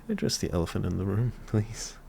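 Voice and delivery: usual speaking voice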